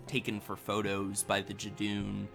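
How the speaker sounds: ordinary delivery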